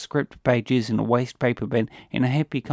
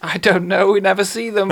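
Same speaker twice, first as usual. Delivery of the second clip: laughing; 7 semitones higher, 6 dB louder than usual. Acted. Laughs at his own joke.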